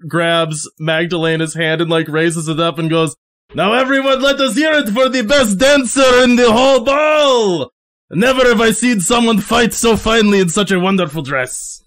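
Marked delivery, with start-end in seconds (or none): 3.5-11.8 higher, ringing proclamation to a ballroom; triumphant Nostroy announcing. Acted. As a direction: call the crowd to applaud the best dancer. Nostroy hails the dancer to crowd.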